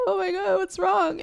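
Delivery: high-pitched, dismayed outcry; mock dismay. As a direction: retell it as an appalled, high exclamation. Acting out a shocked newcomer.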